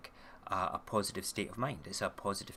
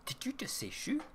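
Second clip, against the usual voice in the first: delighted disbelief; 11 semitones higher than usual. Retold or imitated. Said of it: "And I'm like".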